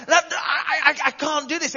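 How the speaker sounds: high, stammering panic with a nervous laugh; panicked Moses. Retold or imitated. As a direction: squeak it out like a man losing his nerve. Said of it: "Moses just, like, freaks out".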